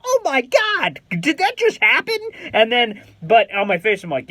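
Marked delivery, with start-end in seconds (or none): 0.1-2.2 excited disbelief. Retold or imitated. Inner disbelief after fist bump.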